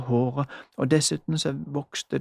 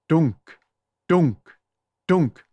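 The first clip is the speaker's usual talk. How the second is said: loud thudding knock sound; imitated knock; 2 semitones higher, 8 dB louder, much slower than usual. A narrator's reading. Narrator voicing Julie's fists on the door, loud knock. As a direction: say it like a fist on wood.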